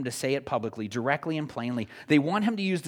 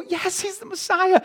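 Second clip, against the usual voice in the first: much higher, louder eager cry; hammed-up excited crowd; 5 dB louder than usual. Preacher acting followers shouting their discovery, excited. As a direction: cheer it like a thrilled fan.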